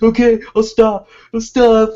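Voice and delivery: high, loud whiny surrender; hammed-up monster pleading; mock pleading monster. Monster voiced giving in mockingly.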